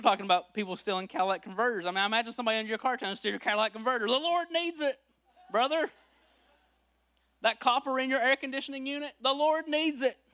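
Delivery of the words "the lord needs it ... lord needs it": higher, hammed-up preachy voice, laughing through; mock pious thief. Thief mock-preaching to the car owner, laughing. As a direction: lay the holy excuse on thick, chuckling. Mocking thieves' pious excuse.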